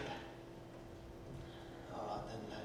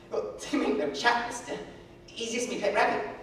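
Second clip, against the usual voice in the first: put-on higher, earnest young boy voice; poor orphan boy; 8 semitones higher, 3 dB louder than usual. Orphan boy answers the blind peddler, earnest and higher. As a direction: play the ragged orphan boy.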